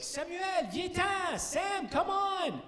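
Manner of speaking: put-on high, slow coaxing call to a child; sing-song teacher coaxing a kid to come in. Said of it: "the teachers are like".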